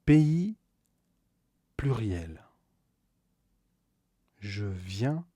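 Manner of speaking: normal talking voice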